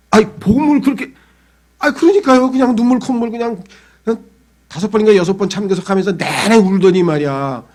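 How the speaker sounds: exasperated disbelief. Retold or imitated. Members hearing he now visits fortune tellers, high exasperated outcry. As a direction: throw up your hands in disbelief.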